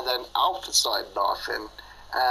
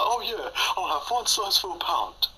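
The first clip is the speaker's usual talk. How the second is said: higher put-on dopey offer; mock goofy voice; 9 semitones higher than usual. Imitating friend offering a pound, goofy higher voice. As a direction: do him as a clueless chancer. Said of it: "and he's like".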